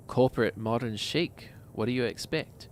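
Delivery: ordinary delivery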